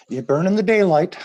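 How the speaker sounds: high, teasing wake-up nag; wake-up call. Retold or imitated. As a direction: chide him out of bed, high.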